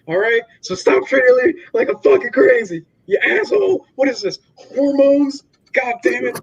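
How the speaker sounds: mock meltdown, swearing at her; ranting Mark.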